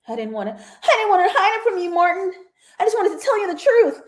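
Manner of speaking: high, fast, teary mock plea; mock tearful confession. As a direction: imitate a crying confession, laughing through it. Acting out Elliot's tearful confession.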